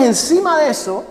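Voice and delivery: normal talking voice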